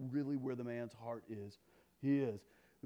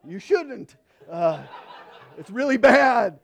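mock dismissive; 9 semitones higher, 16 dB louder, slower than usual. And I'm going.

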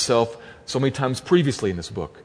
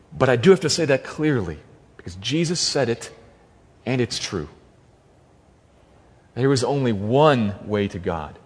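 ordinary talk

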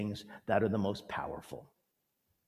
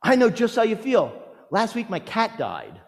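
high, louder glib sympathy; mock platitude; 10 semitones higher, 7 dB louder than usual. Mocking a friend comparing grief to a cat. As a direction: parody a tactless friend. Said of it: "My personal favorite".

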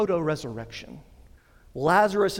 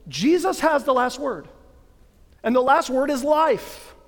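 rising, louder preacher's proclamation, building in fervour; preaching at full force; 6 semitones higher, 4 dB louder than usual. Acted. Preacher to his congregation, driving home Easter's victory, full force. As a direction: proclaim it like the climax of an Easter sermon.

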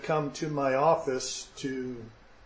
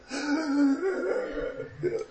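horrified gasp; 10 semitones higher, 3 dB quieter than usual. Acted. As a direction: gasp in mock panic. Sudden realization in retold story.

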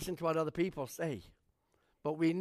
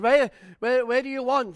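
higher, louder, tumbling repeats; eager questions; 6 semitones higher, 10 dB louder than usual. Retold or imitated. Pastor eagerly asking the lost man where he wants to go, excited. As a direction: rush to help, words tumbling.

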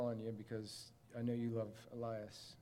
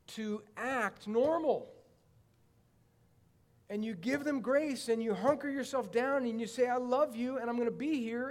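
preaching at full force, much higher and louder; fiery preaching; 8 semitones higher, 9 dB louder than usual. Acted. Pastor urging his small group to bear with hurting people, preaching at full force. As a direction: build it like a pulpit sermon, pleading with the room.

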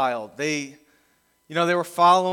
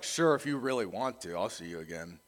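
imagined grudging sure; 9 semitones lower, 8 dB quieter than usual. Acted. Imagined cold reply.

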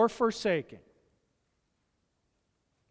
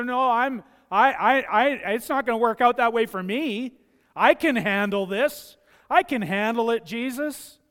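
loud, stammering self-assurance; stubborn insistence; 6 semitones higher, 8 dB louder than usual. Acted. Believer loudly insisting to Jesus he can handle it. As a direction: stumble over it but refuse to back down.

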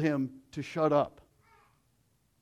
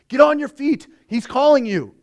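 higher, louder urging; urging crowd; 8 semitones higher, 7 dB louder than usual. Retold or imitated. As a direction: rouse him to his feet. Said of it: "they urged him to go to Jesus".

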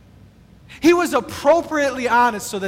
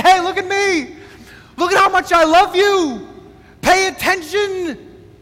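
mock attention-seeking; 6 semitones higher than usual. Imagined showy Jesus.

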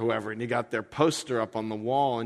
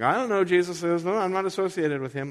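higher, hurried mock disavowal; hasty denial; 6 semitones higher, faster than usual. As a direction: back away fast, disowning him. Peter's denial acted out, bailing out.

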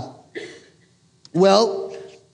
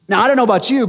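normal talking voice

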